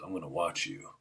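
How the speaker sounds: put-on low, soft older man's voice; older coach. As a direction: imitate a coach's quiet warning. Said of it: "it was an older man".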